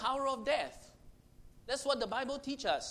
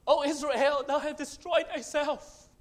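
anguished cry; 5 semitones higher, 6 dB louder than usual. Acted. Repeating the verse as anguished cry.

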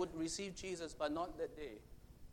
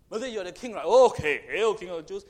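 mocking; 13 dB louder than usual. Soldiers insulting Jesus.

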